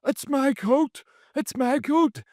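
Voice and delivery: high, loud whiny insistence; high indignant whine. Retold or imitated. Drunk lad insisting to police, high indignant whine. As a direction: whine it like a wronged child.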